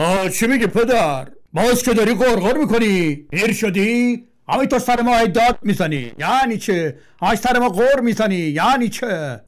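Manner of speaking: put-on high, loud scornful young man; mock your nagging father; rebellious son talks back, high and loud; rude son.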